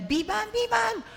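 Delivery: put-on very high, loud child's cry; toddler calling out. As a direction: shout it like a little one. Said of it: "Yung ibig sabihin niya". (sigaw ng batang tumatawag)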